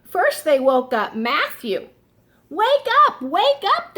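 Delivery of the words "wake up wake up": high calling wake up; shouting. A narrator's reading. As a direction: call out to wake them. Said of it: "they shouted".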